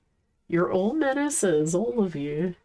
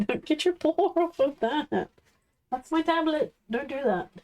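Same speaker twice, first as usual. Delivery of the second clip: higher mock-scolding pet voice; high playful scold; 6 semitones higher than usual. Real, not acted. Streamer scolding her dog off the tablet, playfully. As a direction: tell the pup off half-laughing.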